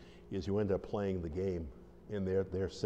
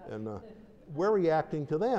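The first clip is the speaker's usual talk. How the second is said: usual speaking voice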